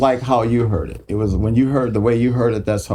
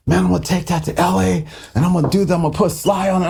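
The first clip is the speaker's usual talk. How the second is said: higher, insistent producer voice; pitched-up McLean; 7 semitones higher, faster than usual. Retold or imitated. Producer announcing his plans for the track, pitched up. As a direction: push it up high and sure of himself. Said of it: "and he goes".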